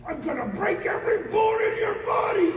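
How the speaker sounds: high, loud strained scream; full-voiced high-pitched mock rage; screaming grandfather. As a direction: scream the threat like an enraged grandfather. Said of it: "a way of screaming like".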